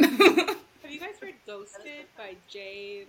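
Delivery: bursting with laughter over the book; laughing excitedly. Real, not acted. Asking friends about a favorite book, laughing loudly.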